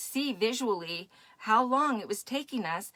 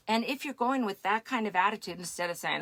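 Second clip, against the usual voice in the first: usual speaking voice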